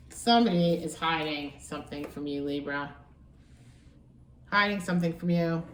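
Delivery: ordinary talk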